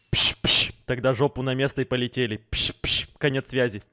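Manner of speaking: low, soft, fast radio call; over walkie talkie. Pretend. Signing off pretend radio. (тихо и низко, как по рации)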